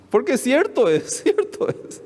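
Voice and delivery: high, breathy laughing agreement; giggling through the words. Real, not acted. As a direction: laugh it out while admitting it. Laughing agreement about wife's joke.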